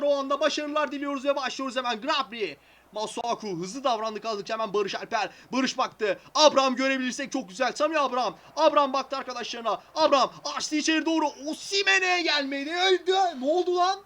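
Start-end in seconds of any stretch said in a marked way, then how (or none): 2.0-11.3 running match commentary, quick and lively; play-by-play commentary. Acted. Commentating his game to viewers, play-by-play. 11.5-14.0 high, loud disbelief; shocked outburst. Real, not acted. Reacting to a missed chance, shocked yelp.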